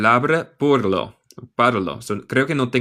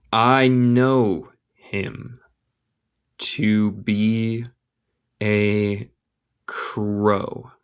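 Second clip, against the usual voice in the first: very slow, drawn-out words with gaps; word-by-word model; much slower than usual. Shown as a demonstration. Teacher modelling a line for learners, word by word. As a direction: dictate it in small pieces.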